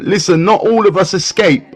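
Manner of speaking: usual speaking voice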